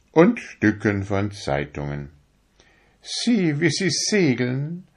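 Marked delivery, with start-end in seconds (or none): 3.0-4.9 high, proud little needle voice; a needle showing off how fine it is; vain darning needle. A narrator's reading. To the fingers, a vain needle in a high proud voice. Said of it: "sagte die Stopfnadel".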